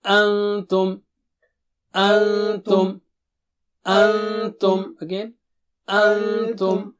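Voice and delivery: teacher modelling "Antum"; clear recitation. Shown as a demonstration. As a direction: repeat "Antum" clearly with the nasal n. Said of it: "So repeat after me".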